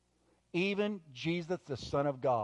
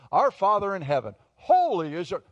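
loud, raised, showy praying voice; mock profound prayer; 4 semitones higher, 7 dB louder than usual. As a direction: parody a public prayer, pitched up and projected. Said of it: "profound prayer".

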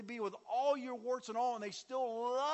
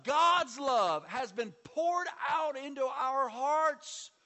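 slow, loud, higher preaching; 6 semitones higher, 8 dB louder, much slower than usual. Acted. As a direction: proclaim it slowly from the pulpit. Preaching at full force.

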